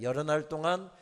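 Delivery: slow, loud solemn reading; a slow, loud Bible reading; scripture reading. Acted. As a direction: read the verse slowly and gravely. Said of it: "이렇게 묘사되어 있습니다".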